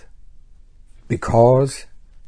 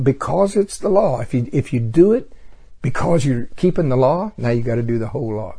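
ordinary delivery

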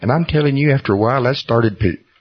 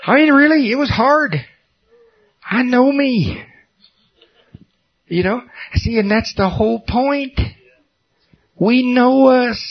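high emphatic. Real, not acted. High-pitched emphatic confession.

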